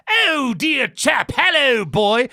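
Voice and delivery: high, very slow mock British gentleman; posh British parody. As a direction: ham up a fancy English gent. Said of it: "he would be like".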